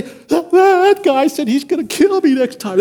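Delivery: an eighth grader telling the coach in alarm; retold alarm. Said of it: "I say".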